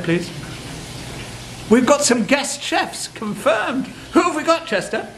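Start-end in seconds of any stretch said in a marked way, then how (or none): 1.7-5.1 high, thrilled reaction to a pretend phone message; excited news. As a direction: gush over the surprise news with a laugh. Pretend call announcing guest chefs.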